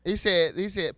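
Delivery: ordinary talk, nothing marked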